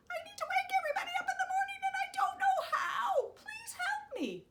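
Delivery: very high, very fast pleading; a desperate rooster begging; frantic plea. Acted.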